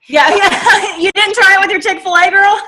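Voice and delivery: high, loud teasing through laughter; laughing tease. Real, not acted. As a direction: rib your friend, laughing as you ask. Teasing co-host about untried pitch.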